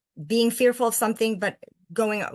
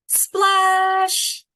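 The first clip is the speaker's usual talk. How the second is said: much higher and louder splash cry; big splash; 11 semitones higher, 7 dB louder than usual. A narrator's reading. Reading to kids, Jabari hits water, loud splash. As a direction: burst out with the splash. Read-aloud splash word.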